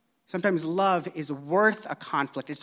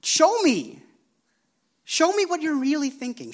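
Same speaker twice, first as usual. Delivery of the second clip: urgent plea; 10 semitones higher than usual. Retold or imitated. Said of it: "Emily was just like".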